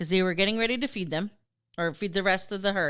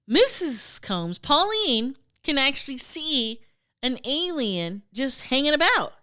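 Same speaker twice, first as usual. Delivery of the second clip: normal talking voice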